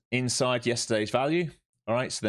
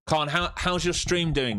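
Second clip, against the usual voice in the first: mocking taunt; 9 semitones higher, 3 dB louder, slower than usual. Real, not acted. Taunting a rival streamer.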